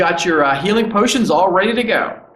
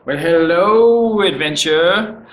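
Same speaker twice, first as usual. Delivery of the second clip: hammed-up greeting; 3 semitones lower, slower than usual. Sudden greeting to the viewers.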